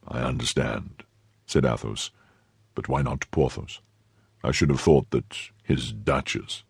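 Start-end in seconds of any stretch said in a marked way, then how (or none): 0.1-1.0 low, soft, sober man; grave Athos. A narrator's reading. Athos answering his friend, low and grave. 2.7-6.6 low, soft, slow musketeer; grave Athos. A narrator's reading. Athos suggesting Porthos to his friend, low and grave.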